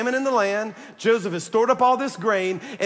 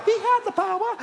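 He had the power, jumping way higher; high repeat. Shown as a demonstration.

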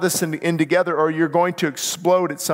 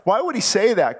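ordinary talk

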